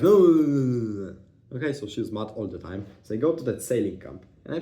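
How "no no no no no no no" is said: loud, higher burst of no's fading at the end; mock refusal, loud then trailing off; refusing loudly. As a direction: bark the no's then let them drop. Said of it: "And she was like".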